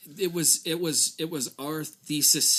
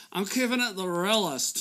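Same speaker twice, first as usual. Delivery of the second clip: mock party bro; 7 semitones higher than usual. Mocking a party show-off.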